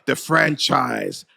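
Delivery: normal talking voice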